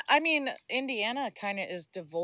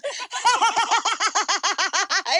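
loud, high cackling burst; laughing; 12 semitones higher, 5 dB louder than usual. Real, not acted. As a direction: cackle hard at your own joke. Friends laughing at roast.

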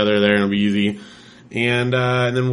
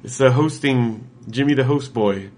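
ordinary talk

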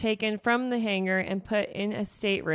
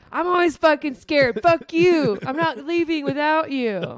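defiant shout; 6 semitones higher, 5 dB louder than usual. Retold or imitated. Defiant Lyria shouting at Volga.

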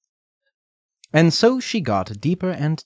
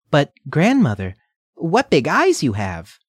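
put-on little girl voice; 5 semitones higher, 4 dB louder than usual. Redcap questions grandmother.

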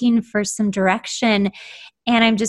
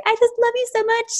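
high, fast starstruck gush; gushing fangirl; 14 semitones higher, faster than usual. Retold or imitated. Fan meeting her songwriting idol, gushing high and fast. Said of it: "I was such a fangirl".

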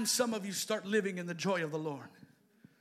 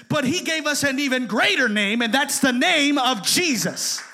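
rising preacher's call; preaching; 6 semitones higher, 12 dB louder than usual. Acted. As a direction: proclaim it to the church, louder each phrase. Preacher building to a shout.

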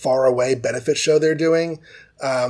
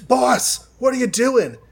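high, anxious mock call to the boss; calling through a locked door, pitched way up; worried call through a door; 9 semitones higher than usual. Assistants pounding on the office door, high worried call.

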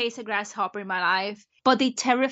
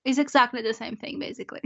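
usual speaking voice

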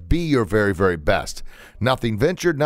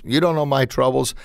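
ordinary talk

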